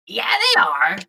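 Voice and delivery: very high put-on voice; excited character voice. Bug confirming the adventurers to his captain, high squeaky voice. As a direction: answer in a squeaky little voice.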